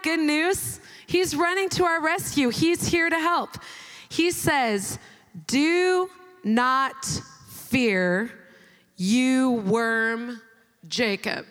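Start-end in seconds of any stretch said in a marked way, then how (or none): 5.5-11.5 low and deliberate, pausing between words; solemn scripture reading. Acted. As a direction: give each word its own weight. Reads scripture aloud.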